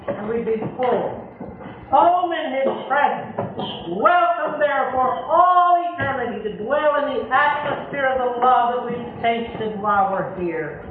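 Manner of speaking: ordinary delivery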